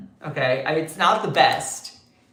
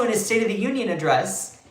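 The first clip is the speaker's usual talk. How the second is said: ordinary talk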